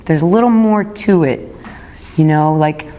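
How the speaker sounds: ordinary talk, nothing marked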